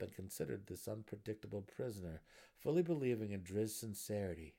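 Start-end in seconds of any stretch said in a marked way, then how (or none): none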